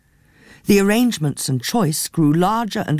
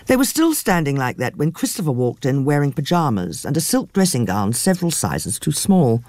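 usual speaking voice

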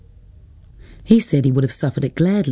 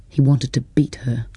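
plain reading voice

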